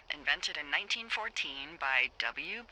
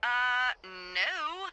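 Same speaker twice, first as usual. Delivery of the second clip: high, loud, obvious-answer no; emphatic incredulous no; 6 semitones higher, 3 dB louder than usual. Acted. Boss answers whether fireworks are legal, incredulous. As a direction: make it an appalled 'of course not'.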